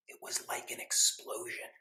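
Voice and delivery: breathless whisper of amazement; whispered wonder. Acted. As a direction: hush it like a secret. Dramatic story climax.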